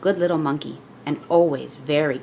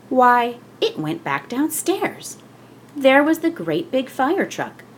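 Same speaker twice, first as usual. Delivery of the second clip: amazed wonder; 7 semitones higher than usual. A narrator's reading. Reading to a child, marveling at the fire truck.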